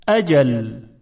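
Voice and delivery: أجل opened with a clean glottal stop; hamza pronunciation. Shown as a demonstration. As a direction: start أجل with a light hamza, no squeeze. Teacher contrasts hamza with ayn. (تلفظ أجل با همزه)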